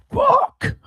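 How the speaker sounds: high squeaky cry of a toy. Pretend. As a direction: squeal it like a squeezed stress ball. Stress ball toy swearing.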